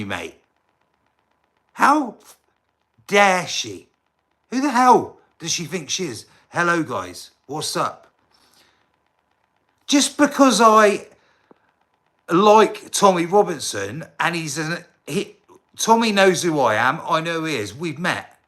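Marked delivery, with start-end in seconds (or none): 1.7-6.3 high, loud outrage; indignant outburst. Real, not acted. Vlogger outraged that the bank clerk hung up, indignant. 9.9-16.7 raised voice, high and loud; indignant protest. Real, not acted. Vlogger protesting the bank snub over his politics, indignant. Still outraged at being hung up on.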